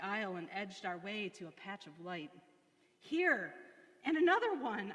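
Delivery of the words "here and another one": high, louder anguished wail. A narrator's reading. Corrie finds more fleas, wailing in dismay. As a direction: cry out in dismay. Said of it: "I wailed".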